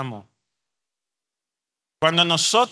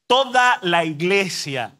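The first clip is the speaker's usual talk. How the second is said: usual speaking voice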